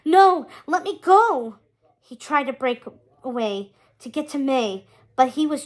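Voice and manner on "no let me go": panicked scream. Acted. Trapped by demons, screaming.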